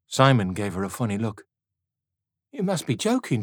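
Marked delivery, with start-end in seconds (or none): 2.5-3.4 put-on incredulous young man. A narrator's reading. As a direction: scoff at her. Simon retorts.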